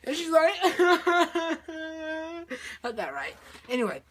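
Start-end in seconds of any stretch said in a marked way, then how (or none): 0.6-2.8 high, stuttering cry; hammed-up broken howl; mock sobbing wail. She watches him fly away, mock wail.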